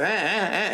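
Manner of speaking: high-pitched mock baby fussing; imitated baby cry. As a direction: mimic a fussy baby's short cries. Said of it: "if the baby goes".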